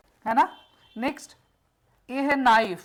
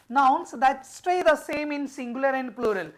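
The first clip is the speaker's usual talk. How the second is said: slow rule reading for notes; slow dictation; 3 semitones higher, much slower than usual. Shown as a demonstration. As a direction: dictate it word by word. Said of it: "reading rule aloud".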